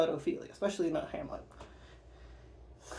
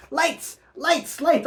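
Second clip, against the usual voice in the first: high repeated calls for lights; calling out; 8 semitones higher, 3 dB louder, much slower than usual. Acted. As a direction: cry out for the lights.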